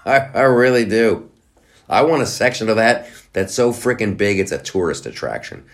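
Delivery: normal talking voice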